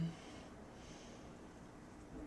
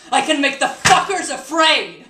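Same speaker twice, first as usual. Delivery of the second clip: explosive high, loud yell; furious shout; 7 semitones higher, 16 dB louder than usual. Acted. Vowing to Harry to terrify monsters, furious shout. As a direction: shout the vow with full force.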